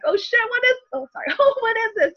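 high, alarmed gasp of words; frightened, realizing something is there; spooked exclamation. Retold or imitated. Seeing son's terror at closet.

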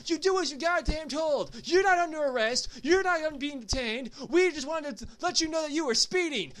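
high-pitched, rapid mock tirade; imitating a drill-sergeant dressing-down; angry drill instructor. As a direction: lay into them like a fed-up old cop. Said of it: "yells at".

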